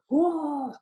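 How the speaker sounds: a high startled whoa, lightly acted; retold whoa. Recalling being tangled on the highline, startled whoa. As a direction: yelp it like the leash just caught you. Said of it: "I was like".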